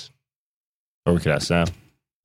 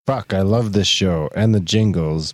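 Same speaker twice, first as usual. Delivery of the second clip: ordinary delivery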